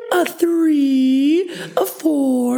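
put-on high squeaky toddler voice; baby talk. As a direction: talk like a little kid. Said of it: "talking to the guy on the phone like a baby".

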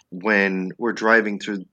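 ordinary talk, nothing marked

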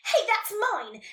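high, loud protest; shouted. A narrator's reading. Nelly startling the unicorn, shouting. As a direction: shout to make someone jump. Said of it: "Nelly shouted".

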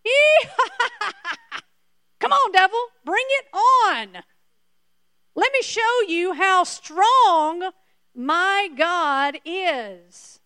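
high, loud, laughing dare to the devil, slowing at the end; defiant taunting Paul. Retold or imitated. Paul daring the devil to bring it on, high laughing taunt. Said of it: "Instead, he was like".

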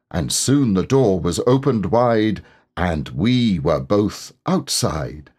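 bouncy sing-song verse; recited poem. A narrator's reading. Pooh reciting the Piglet hum to himself, sing-song. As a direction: chant it gleefully like a nursery hum.

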